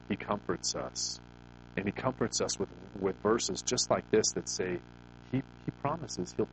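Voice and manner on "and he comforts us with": ordinary talk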